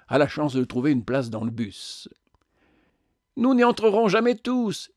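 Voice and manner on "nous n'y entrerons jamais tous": much higher plaintive wail; high moaning complaint. A narrator's reading. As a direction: whimper it in despair. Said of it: "gémit une voix de femme".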